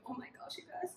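soft, voiceless oh my gosh; whisper. Real, not acted. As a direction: breathe it out in awe. Happy about the typed note.

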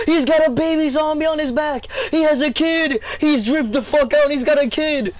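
high, loud excited gush. Real, not acted. Excited about dripped zombie.

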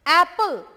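एप्पल with a loud, open first vowel; demo of the a sound. Shown as a demonstration. Teacher models एप्पल loudly for students. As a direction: stretch the a in एप्पल. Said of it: "ये ए जो sound आ रहा है".

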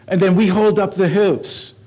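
loud, high, driving sermon voice; preaching at full force. Acted. Pastor preaching hard to his congregation, loud and driving.

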